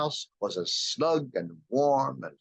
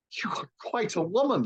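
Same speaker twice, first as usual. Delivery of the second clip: put-on exclaiming boy; 10 semitones higher than usual. A narrator's reading. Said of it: "exclaimed the boy".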